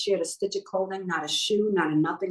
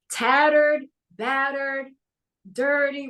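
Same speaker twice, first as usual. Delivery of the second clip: emphatic listing; 5 semitones higher, slower than usual. Real, not acted. Vivid description of homeless man.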